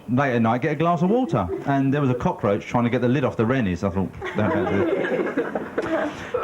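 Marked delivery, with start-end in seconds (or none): 4.4-6.4 very slow, drawn-out, higher plea; slow pleading voice. Acted. Comic warns the cockroach in a very slow, high plea. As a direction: plead with the bug, stretching every word.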